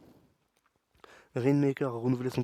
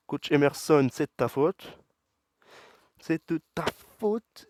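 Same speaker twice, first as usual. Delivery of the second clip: annoyed outburst; 3 semitones higher, 7 dB louder than usual. Real, not acted. Angry outburst at coach after loss.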